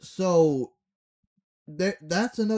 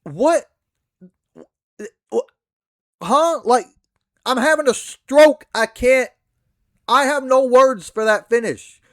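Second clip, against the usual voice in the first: higher and louder, in disbelief at the finish; exasperated outburst; 7 semitones higher, 9 dB louder than usual. Real, not acted. Ranting wrestler blasting the finish he was given, exasperated and loud. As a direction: blow up like you just watched a terrible finish.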